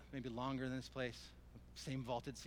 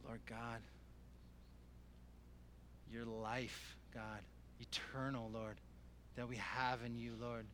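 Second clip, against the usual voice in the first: hushed prayer; 5 dB quieter, slower than usual. Real, not acted. Preacher praying to God about eternal life and grace, hushed and reverent. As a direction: pray quietly, leaving room between phrases.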